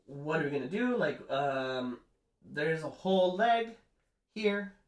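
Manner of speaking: high, loud panicked rush of questions; freaking out. Retold or imitated. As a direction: act out the werewolves panicking over the leg. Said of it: "they start freaking out".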